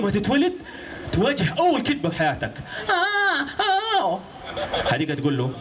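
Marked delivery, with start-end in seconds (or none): 2.9-4.2 put-on high wailing baby cry. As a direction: wail like a newborn. Newborn baby crying.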